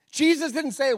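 ordinary talk, nothing marked

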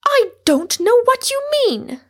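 very high, loud protest; stout denial. A narrator's reading. Rosamond refusing to understand her suitor, stoutly. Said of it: "said Rosamond stoutly".

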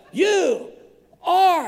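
high, loud, word-by-word mock admiration; awestruck mock exclamation. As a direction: imitate an overwhelmed admirer. Retold compliment, word by word.

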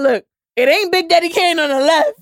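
high, loud, laughing retold quote; loud and high, breaking into laughter; laughing retort. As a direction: crack up while delivering the punchline.

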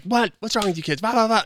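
high-pitched mock parent tirade; imitated losing it. Coach imitates mother yelling at her kids, mock tirade. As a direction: rant like an exasperated parent, hammed-up. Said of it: "lose your shit and start talking that way".

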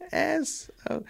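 normal talking voice

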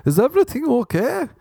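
high, worried German woman; lightly mocked German accent; mock German girlfriend. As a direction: fret in a high voice with a German lilt. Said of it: "she's just like".

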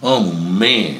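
mock pain held in through clenched teeth; through gritted teeth. Guest acting a youth enduring circumcision, through gritted teeth. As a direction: grit your teeth and bear it. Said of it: "gnashing your teeth and just saying".

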